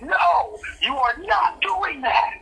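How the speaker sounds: high-pitched, drawn-out mock-outraged no; outraged refusal. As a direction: scold in a high, slow voice, theatrically appalled. Retells own outraged refusal.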